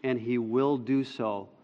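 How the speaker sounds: low, soft pastoral exhortation; tender preaching. Real, not acted. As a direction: speak gently to the congregation, with long pauses.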